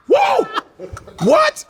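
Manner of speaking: very high shouted surprise; a high, hammed-up alarmed whoa; mock shock. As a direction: gasp it out very high. Surprised child reaction.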